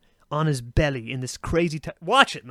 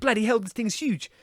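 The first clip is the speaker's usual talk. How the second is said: put-on lightly done South African accent, a touch higher; 6 semitones higher than usual. Wildlife host pulling out a python, light South African accent. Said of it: "so he talks like this".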